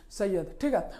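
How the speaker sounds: ordinary talk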